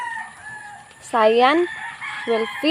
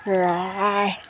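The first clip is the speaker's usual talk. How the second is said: lower, softer growly roar; 7 semitones lower, 6 dB quieter than usual. Shown as a demonstration. Parent echoes the tiger sound, low soft roar. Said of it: "What about the tiger".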